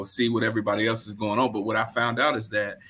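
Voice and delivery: usual speaking voice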